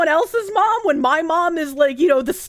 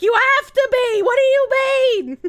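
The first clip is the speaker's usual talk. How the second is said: high, loud protest; mock indignation; 11 semitones higher, 6 dB louder than usual. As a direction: fire back in a high yell. Mock protest at chat remark.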